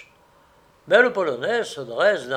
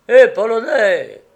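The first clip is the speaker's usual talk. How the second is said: loud, higher hail; calling out; 6 semitones higher, 12 dB louder than usual. A narrator's reading. Gamekeeper hailing the Polish man, calling out. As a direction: hail him sharply across a fence. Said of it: "réitère le garde".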